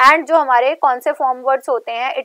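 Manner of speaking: ordinary talk, nothing marked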